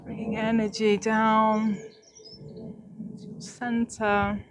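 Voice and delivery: slow, soft meditation voice; guided meditation. Acted. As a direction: ease them into calm breathing, slowly. Leads centering breathing meditation.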